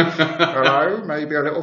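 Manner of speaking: high, loud, unsure tourist voice; mock bumbling Englishman abroad; hesitant English Spanish speaker. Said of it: "English people speaking Spanish are like".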